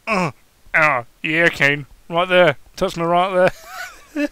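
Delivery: loud, breathless moaning; pleasured moaning. Acted. Daniel urging Kane on, loud moaning. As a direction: moan the words out.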